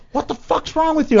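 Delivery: high mock angry bassist; bassist snapping. Bassist scolding him onstage, high mock anger. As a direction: play the annoyed bass player. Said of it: "he goes".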